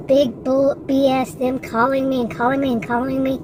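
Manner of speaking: ordinary talk, nothing marked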